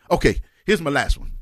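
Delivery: usual speaking voice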